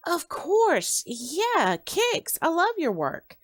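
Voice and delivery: high-pitched fan excitement; excited, voice leaping up high; enthused. Acted. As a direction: gush as a fan recognising someone. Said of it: "The young man enthused".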